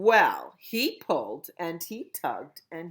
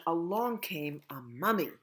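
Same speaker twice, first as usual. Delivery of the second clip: ordinary delivery, nothing marked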